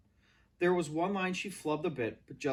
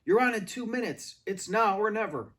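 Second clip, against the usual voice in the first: hush shouting; 7 semitones higher, 6 dB louder than usual. A narrator's reading. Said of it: "hush shouting".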